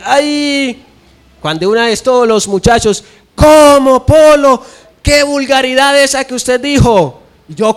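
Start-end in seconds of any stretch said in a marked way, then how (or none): 0.0-0.9 a short ay of pain, pitched high; a quick high ouch. Retold or imitated. 3.3-7.2 scandalized classmates. Retold or imitated. Compañeros escandalizados por la vulgaridad.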